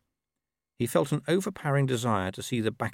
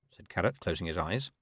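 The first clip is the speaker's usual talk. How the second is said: usual speaking voice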